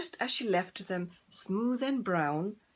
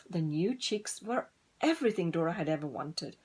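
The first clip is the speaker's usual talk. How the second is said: plain reading voice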